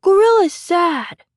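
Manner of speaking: put-on high, loud, drawn-out child voice; little boy. A narrator's reading. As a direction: toddler announcing it loudly. Small boy mimics gorilla.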